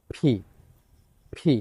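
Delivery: ফি with a strong puff of air after the P; aspirated P demo. Shown as a demonstration. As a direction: push the air out hard on ফি. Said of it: "ফ sound".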